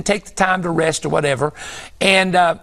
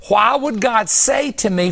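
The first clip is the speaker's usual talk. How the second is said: usual speaking voice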